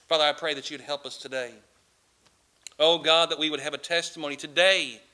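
starts hushed, then builds to a loud, fervent plea; pray, rising in fervour with each line; closing prayer. Real, not acted. Pastor praying over his online congregation, building from hushed to fervent.

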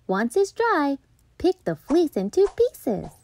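usual speaking voice

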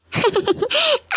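high chuckling laugh; amused laugh. Acted. Grandmother caught teasing her granddaughter, amused chuckle. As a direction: chuckle at the fooled girl.

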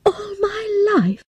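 high, tearful cry of shock; crying out in disbelief. Acted. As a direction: wail it in despair. Girl hears shocking news.

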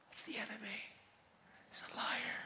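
hushed, breathy aside; whisper. Real, not acted. Preacher warning his congregation, whispered. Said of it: "preacher drops to whisper".

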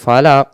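ফালাক with the final q let go in a small echo; released qaf. Shown as a demonstration. Teacher showing correct ending, ফালাক with bouncing q. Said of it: "উচ্চারণটা ছেড়ে দেব".

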